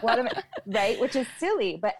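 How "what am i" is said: laughing. Retold or imitated. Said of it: "I feel like".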